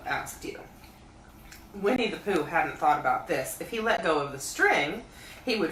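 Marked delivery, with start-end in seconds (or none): none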